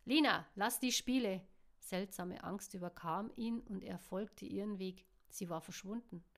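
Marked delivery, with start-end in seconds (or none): 0.0-1.4 loud, raised command; exasperated loud call. A narrator's reading. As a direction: shout after her to stop. Calling after her to stop playing.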